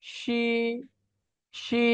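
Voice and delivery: শি with a long vowel; Chinese X sound. Shown as a demonstration. Teacher showing how X is pronounced, drawn-out শি. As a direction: draw out শি, thin and airy at the front. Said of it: "এক্স এর উচ্চারণ হবে".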